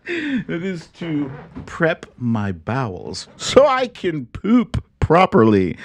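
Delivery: words squeezed out through giggles; embarrassed giggling; laughing. Real, not acted. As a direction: laugh helplessly while explaining. Laughing about bowel prep.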